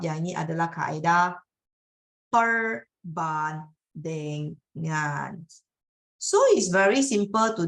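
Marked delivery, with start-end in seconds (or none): 2.3-5.4 perbandingan drawn out very slowly, syllable by syllable; slow, deliberate emphasis on perbandingan. Shown as a demonstration. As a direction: stretch each syllable of perbandingan for the class. Teacher spells out the key term.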